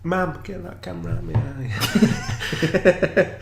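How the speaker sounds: high, slow Northern English whine; mock Yorkshire lad, high and drawn out; Northern accent. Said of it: "why are we doing Northern accents".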